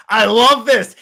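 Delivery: loud, relishing battle cry; Wonder Woman. Acted. Warrior meeting a good enemy, gleeful and loud. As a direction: punch it out, enjoying the fight. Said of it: "she'd be like".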